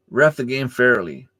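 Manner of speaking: ordinary talk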